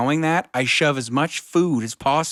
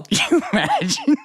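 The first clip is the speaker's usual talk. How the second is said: laughing; 10 semitones higher than usual. Real, not acted. Laughing excited exclamation.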